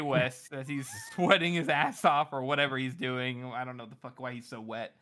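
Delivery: ordinary delivery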